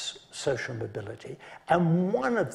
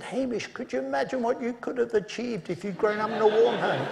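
laughing retort; 7 semitones higher than usual. Retold or imitated. Retorting to colleague, amused.

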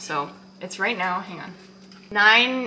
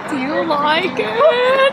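excited; 9 semitones higher, 9 dB louder, slower than usual. Real, not acted. Eager question.

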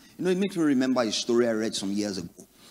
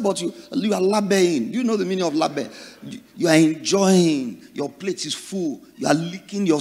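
higher, louder teasing explanation; playful insistence; 6 semitones higher, 7 dB louder than usual. Retold or imitated. Husband explaining the greeting to his wife, loud and teasing. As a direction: drive the joke home to his wife.

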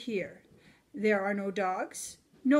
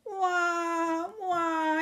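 high, wailing little mouse cry; crying Baby Mouse; 7 semitones higher than usual. A narrator's reading. Baby Mouse fell and wails for help, high wailing. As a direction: wail like a tiny lost baby. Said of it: "he cries".

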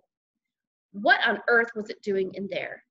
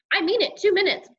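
high, louder insistent warning; stern mother; 10 semitones higher, 5 dB louder than usual. A narrator's reading. Mother warning son before bedtime, stern. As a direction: lay down the deadline, pitched up.

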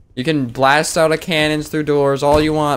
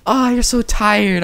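put-on high, slow cooing to a baby; mock baby talk; 9 semitones higher, slower than usual. Gamer cooing at the tired baby, slow and high. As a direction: coo it sweetly, mocking.

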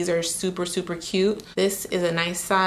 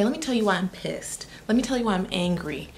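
usual speaking voice

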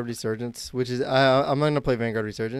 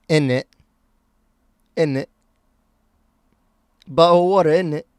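mock London British accent, a bit higher; Tris British accent; 4 semitones higher, 7 dB louder than usual. Streamer imitating his British friend for chat, mock British accent. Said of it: "Do I sound like Tris?".

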